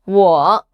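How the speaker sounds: "Wo." dipping low, then bouncing up; low falling-rising "Wo."; third tone. Shown as a demonstration. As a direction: drop low in "Wo." and lift the end. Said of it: "dipped and bounced with the third tone".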